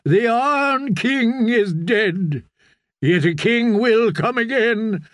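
ringing, drawn-out prophecy, settling at the end; prophet proclaiming. Acted. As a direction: proclaim it to a gathered congregation.

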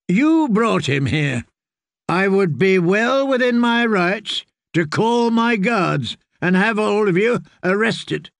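lightly acted lordly outrage; stern threat. A narrator's reading. Lord threatens visitors with arrest.

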